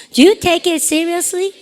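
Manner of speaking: slow question for the class to echo; slow drill. Shown as a demonstration. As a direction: model the question slowly. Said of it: "시작".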